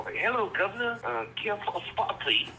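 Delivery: high, hammed-up British accent; mock British accent. Imitating the girl's fake British accent to the interviewer. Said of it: "That's my best British person".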